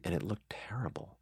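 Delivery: hushed whisper, almost no voice. Real, not acted. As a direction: drop to a whisper for the bad news. Rehearsal went badly, hushed admission.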